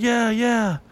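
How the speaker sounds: high, quick casual yeah yeah; brushing him off. Retold or imitated. Guys answering the stranger, high and offhand. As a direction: wave him off lightly. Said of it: "We're like".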